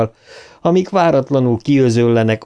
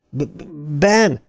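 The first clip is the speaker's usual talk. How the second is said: a sharp stammered call, pitch up; sharp stutter; 5 semitones higher than usual. A narrator's reading. Bill calls Ben sharply, stammering. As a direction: snap his name out through the stutter. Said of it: "mondta élesen".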